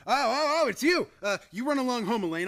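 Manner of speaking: flustered yelp to hide the panic; startled, hurried bluster; flustered startle. Acted. Flustered at fiancée arriving.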